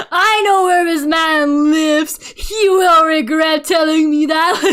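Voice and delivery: half-laughing vow of revenge; mock fury. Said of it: "And I got so mad".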